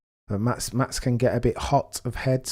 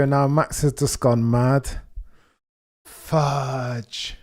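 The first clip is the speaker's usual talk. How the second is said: usual speaking voice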